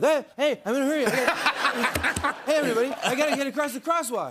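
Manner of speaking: high, slightly winded, laughing host impression; Jimmy. Shown as a demonstration. Comedian showing how to do the host, high winded impression. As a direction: play him flustered and a little out of breath. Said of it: "be slightly out of breath".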